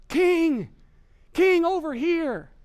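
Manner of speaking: high, drawn-out loud shout; calling out. Acted. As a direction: holler to get the king's attention. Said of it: "And they're saying".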